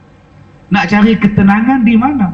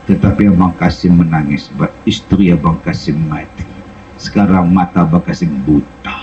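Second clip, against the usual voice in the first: much lower, tender low voice; blind Kasim comforting. Retold or imitated. Blind Kasim consoling the weeping Combi, low and tender. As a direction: console her gently in a low voice.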